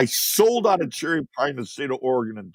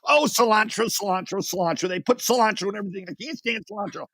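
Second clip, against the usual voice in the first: higher mock wife voice; husband imitates his wife's question in a higher voice; mimicking his wife; 8 semitones higher, faster than usual. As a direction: play the wife, pitched up and light. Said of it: "She goes".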